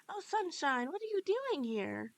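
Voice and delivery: put-on high, bright-eyed childlike character; sing-song, delighted friendly character; cheerful animatronic voice.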